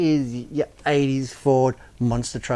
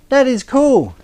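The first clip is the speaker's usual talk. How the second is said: excited; 10 semitones higher, 6 dB louder than usual. Real, not acted. Delighted at the finished truck.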